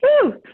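high, loud woo; excited whoop. Real, not acted. Host cheering guest's hello, excited high whoop.

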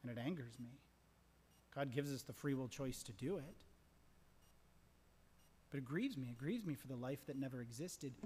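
ordinary delivery

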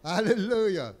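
loud ringing hallelujah; exclaimed praise. Real, not acted. Preacher to congregation, loud joyful praise.

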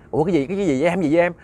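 mildly acted fright; panicked. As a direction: blurt it out in alarm, repeating the words. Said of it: "mới hoảng hồn hoảng vía mới nói".

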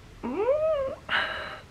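a long, high, overwhelmed sigh; emotional sigh. Real, not acted. Knitter overcome by her finished sweater, sighing. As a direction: let out a full-hearted sigh before the words. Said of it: "I feel so emotional right now".

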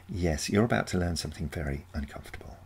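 plain reading voice

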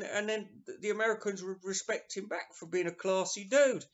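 normal talking voice

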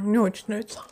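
ordinary talk, nothing marked

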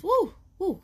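high excited whoop; excited woo. Real, not acted. Reader cheering the healing card.

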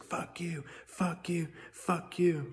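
mock screaming. Brainwashed people answering evidence, imitated in a high mock yell. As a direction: mimic a chanting heckler, high and held back. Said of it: "they're just like".

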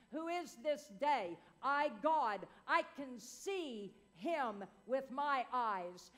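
ordinary delivery

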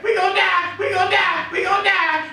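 high, frantic repeated cries; hammed-up high wail of doom; crying out in panic. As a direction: act a crowd wailing in panic. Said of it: "hear all the people crying out there".

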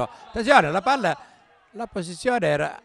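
sudden burst of alarm; excited. Real, not acted. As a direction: call out the danger loudly. Sudden chance in live commentary.

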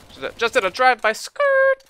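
loud high whoop at a drive-by kill; excited shout. Real, not acted. Streamer celebrating a drive-by kill, shouting thrilled.

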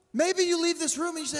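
usual speaking voice

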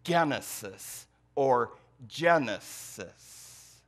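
"genesis" said first with a hard g, then as in Genesis, slowly; two pronunciations. Shown as a demonstration. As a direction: try both ways of saying "genesis", slowly. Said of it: "is the Greek word".

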